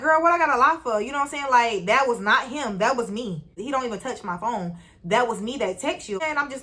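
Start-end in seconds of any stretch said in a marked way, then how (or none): 0.0-1.0 loud, high exasperated question. Retold or imitated. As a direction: push back, fed up. Arguing with friend.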